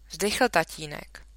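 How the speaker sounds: ordinary delivery, nothing marked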